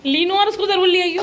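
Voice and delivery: loud, high parting call. Real, not acted. Host telling leaving guests to bring the others, calling out. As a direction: call after departing guests.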